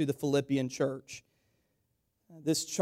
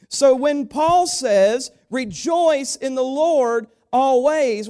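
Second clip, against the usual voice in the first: higher, louder and slow; preached proclamation; 10 semitones higher, 9 dB louder, slower than usual. Acted. Pastor proclaiming Paul's command, loud and slow. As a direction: proclaim it to the church.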